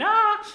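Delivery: high, loud mock scream of "Ah!"; retold scream. Bully girl pinned in the schoolyard, screaming high and loud. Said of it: "she's laid there screaming".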